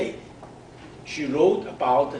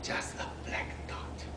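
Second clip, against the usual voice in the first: whisper; 14 dB quieter than usual. Acted. Mother peering at tiny snail.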